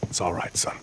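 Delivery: soft whisper. Acted. Colonel consoling corporal privately.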